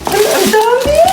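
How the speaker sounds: high-pitched excited exclamation; thrilled surprise. Acted. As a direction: squeal with delight at the treat. Child sees many cheesecakes.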